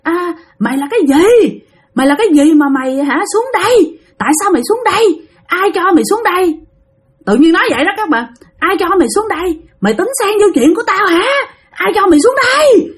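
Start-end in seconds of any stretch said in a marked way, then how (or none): 0.0-6.6 high, fierce scolding cry; ghost mother's angry shout. Retold or imitated. Ghost mother yelling at the dreamer, high and angry. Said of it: "bả làm thấy ghê lắm". 8.6-12.9 high, fierce scolding cry; ghost mother's angry shout. Retold or imitated. Ghost mother yelling at the dreamer, high and angry. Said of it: "Bả làm thấy ghê lắm".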